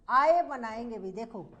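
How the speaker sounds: normal talking voice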